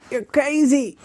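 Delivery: ordinary talk, nothing marked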